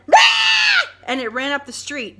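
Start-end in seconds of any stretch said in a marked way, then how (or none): 0.1-0.9 shrill imitated fox shriek, very high and loud; fox scream. Vlogger imitating the fox at the curb, shrieking. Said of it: "And it said".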